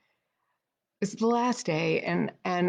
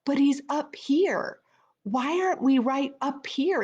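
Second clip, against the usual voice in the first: indignant questioning; 6 semitones higher than usual. Retold or imitated. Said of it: "And I'm like".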